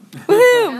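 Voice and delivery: high, loud whoop of joy; excited cheer. Real, not acted. Cheering about apple challah.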